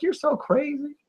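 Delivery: put-on high breathy valley girl. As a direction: squeal it like a gushing teen girl. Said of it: "Can you talk like that for the rest of the night?".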